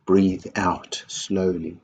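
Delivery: slow, drawn-out guidance; unhurried calming coaching; calming instruction. Shown as a demonstration. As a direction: guide a breath out, very slowly.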